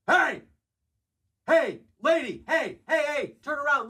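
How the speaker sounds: very high, strained calls after her; hammed-up high yelling man; creepy man calling. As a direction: shout to make her turn. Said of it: "she just hears".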